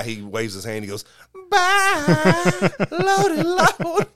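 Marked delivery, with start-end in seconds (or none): 1.5-4.1 put-on very high, slow old Southern lady; a toddler sounding like an old Southern woman, drawn out; high squeaky Southern granny. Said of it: "like this old Southern woman".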